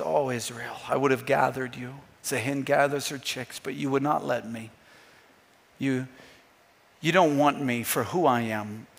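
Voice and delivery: low, very soft lament; hushed, sorrowful pleading, acted gently; weeping Jesus, understated. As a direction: grieve quietly, without audible sobs. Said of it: "He's weeping".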